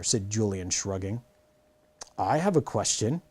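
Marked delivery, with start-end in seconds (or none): none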